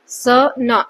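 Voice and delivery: स न as two separate sounds, gap between; स, pause, न, each on its own beat; wrongly split word. Shown as a demonstration. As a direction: break स न apart to show the wrong way. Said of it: "ऐसा नहीं बोलते".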